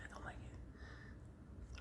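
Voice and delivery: drawn-out whisper, very slow; slowed whispered grumble. Real, not acted. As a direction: stretch the whisper out, sulking. Repeats complaint, slower whisper.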